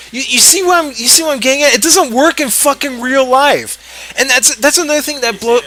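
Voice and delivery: high, worked-up complaint; exasperated rant. Real, not acted. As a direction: argue your point in a high, exasperated voice. Ranting that the rule is absurd.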